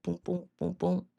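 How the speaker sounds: soft, even heartbeat thumps; imitated heartbeat. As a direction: mimic a little heart thumping quietly. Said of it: "his little heart's like".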